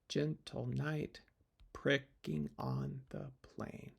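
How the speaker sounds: slow scansion of "Gentle knight pricking on the plain", beats on GEN, KNIGHT, PRICK, ON, PLAIN; five stressed syllables. Shown as a demonstration. Teacher marking five stressed syllables for students, slow beats through "Gentle knight pricking on the plain". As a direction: pick out each iambic beat in "Gentle knight pricking on the plain", slowly and deliberately. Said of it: "five stressed syllables".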